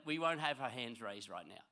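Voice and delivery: ordinary talk, nothing marked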